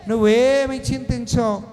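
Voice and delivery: preach. Acted. Pastor urges congregation to cast burdens on God, full-force preaching.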